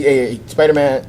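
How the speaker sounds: ordinary talk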